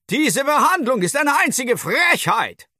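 a pocket-sized man huffing in outrage; a tiny dwarf in a shirt pocket scolds the boy, high and indignant; scolding dwarf. A narrator's reading.

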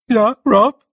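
high, loud, fretful little voice; squeaky wail. Acted. A small blue man wails in fear at the snow. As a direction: wail it like a panicking small creature. Dover answers.

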